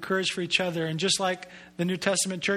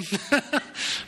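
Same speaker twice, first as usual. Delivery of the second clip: short laugh; 5 semitones higher than usual. Real, not acted. Pastor laughs after story.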